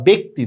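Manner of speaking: ব্যক্তি as "bekti"; e sound. Shown as a demonstration. Teacher gives students an example, ব্যক্তি with "e". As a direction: say ব্যক্তি with a plain "e" vowel. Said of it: "এর উচ্চারণ হয়েছে".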